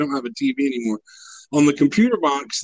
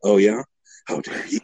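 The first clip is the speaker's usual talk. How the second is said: mock comeback; 6 semitones lower than usual. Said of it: "you fought back with".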